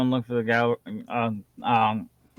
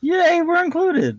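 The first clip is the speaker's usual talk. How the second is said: high, slow sarcastic yay; hammed-up falsetto cheer; mock cheer; slower than usual. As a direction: fake delight at being included.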